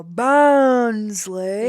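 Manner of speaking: mock surprised echo. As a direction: echo it back in disbelief. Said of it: "they go".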